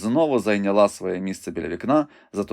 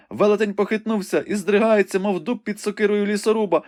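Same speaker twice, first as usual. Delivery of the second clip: joyful excitement; 11 semitones higher than usual. A narrator's reading. Rebecca joyfully tells Ivanhoe the knight rose again, high and excited. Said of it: "скрикнула у радісному збудженні".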